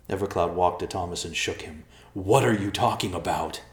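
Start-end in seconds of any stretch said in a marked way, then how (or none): 2.1-3.6 urgent. Acted. Evercloud shakes Thomas.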